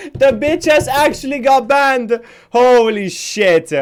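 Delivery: loud, high, delighted disbelief; excited triumphant outburst; gleeful shout. Real, not acted. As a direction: crow over the ban. Thrower got banned, celebrating.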